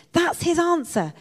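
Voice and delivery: loud, emphatic announcement of discovery; excited interruption. A narrator's reading. Betsy finds the answer in the verse, excited. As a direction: burst in loudly, then press the point home. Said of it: "Betsy interrupted".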